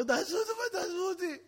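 high, whining mock plea; hammed-up captive's wail; pleading. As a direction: beg to be let go in a high voice. Captive begs to be released.

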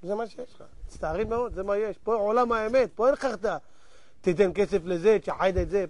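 low, quiet acted refusal; soft, indifferent dismissal; unmoved official. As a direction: answer the wailing low and coolly. Heavenly official coolly refuses plea.

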